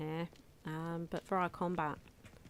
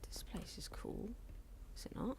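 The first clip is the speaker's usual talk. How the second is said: whispering; 3 semitones higher, 13 dB quieter than usual. Real, not acted. Awed at the new place.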